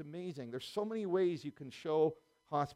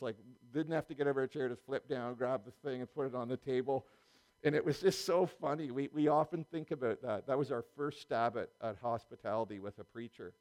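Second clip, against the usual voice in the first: ordinary delivery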